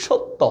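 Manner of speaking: সত্য said as "shotto"; "sh" pronunciation. Shown as a demonstration. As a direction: model সত্য with initial "sh". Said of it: "S H". (সত্য-র শুরুতে "শ" (sh) ধ্বনি)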